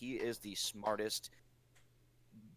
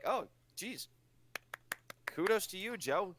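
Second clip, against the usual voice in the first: much higher-pitched retold reaction; mock-impressed reaction; 8 semitones higher than usual. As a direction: pitch it way up, acting out your own reaction. Retelling own reaction to Joe.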